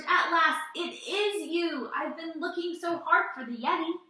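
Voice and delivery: high, eager relief; thrilled at finally finding it; excited. Acted. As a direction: gush with delight. George thrilled to find Yeti.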